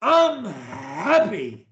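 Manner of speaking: slow, with "happy" drawn out and swooping; meaning it. Shown as a demonstration. Coach modelling for a stutterer to repeat, slow and meant. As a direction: stretch "happy" with a big rise and fall. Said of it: "say it like I mean it".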